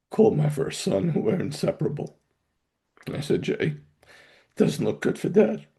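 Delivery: real tearful voice, pitched high; choked up. Real, not acted. Father retelling his goodbye call to his son, choked up. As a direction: fight back tears as the voice climbs.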